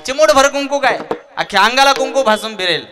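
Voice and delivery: high, loud ringing repetition; preacher echoes the vow, loud; fervent preaching cry. Acted. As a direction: hammer it home to the crowd. Preacher repeats climax.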